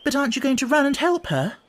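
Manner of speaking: high, astonished question; very surprised. A narrator's reading. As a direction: ask in disbelief at his calm. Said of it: "very much surprised".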